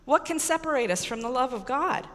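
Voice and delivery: normal talking voice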